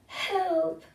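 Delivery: high, loud call for help; little beetle crying help. A narrator's reading. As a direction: yelp it out like a beetle stuck on its back. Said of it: "it cried".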